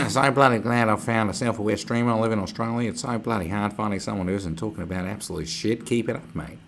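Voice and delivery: hammed-up Australian accent. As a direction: read it like a broad Aussie bloke. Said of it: "read your messages in an Australian accent".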